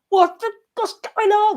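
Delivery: very high, loud scream. Retold or imitated. Driver screaming at frozen phone, very high and loud. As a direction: shriek it at the phone. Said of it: "proper screaming at it".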